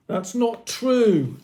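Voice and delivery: yell. A narrator's reading. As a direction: protest the accusation loudly. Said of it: "Akil yelled".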